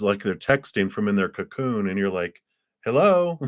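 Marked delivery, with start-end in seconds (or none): 2.8-3.4 high, questioning hello; raised-pitch "anyone in there?" call. Acted. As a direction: call out to someone who isn't answering. Calling to someone inside a cocoon.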